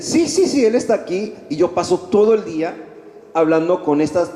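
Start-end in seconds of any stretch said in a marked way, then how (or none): 0.0-1.3 much higher, quick eager yeses; eager friars. Retold or imitated. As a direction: imitate the friars answering brightly. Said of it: "Y los frailes dicen". (frailes respondiendo con entusiasmo, voz mucho más aguda)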